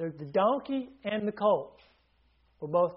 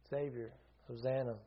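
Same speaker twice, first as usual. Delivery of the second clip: soft reverent; 5 semitones lower, 8 dB quieter than usual. Acted. Preacher softens after meaning.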